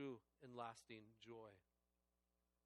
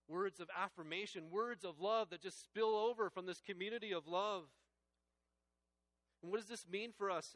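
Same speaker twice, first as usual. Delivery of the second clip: preaching build; 6 semitones higher, 8 dB louder than usual. Real, not acted. Sermon swells with fervour.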